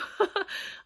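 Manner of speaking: high airy giggle; a quick sheepish high laugh; breathy laugh. Real, not acted. Asking viewers to donate, breathy giggle.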